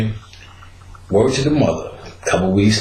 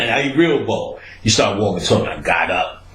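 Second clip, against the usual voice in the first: ordinary delivery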